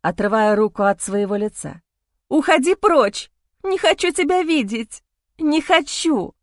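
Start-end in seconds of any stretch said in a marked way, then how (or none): 2.3-6.3 furious shouting. Acted. Wife drives husband away.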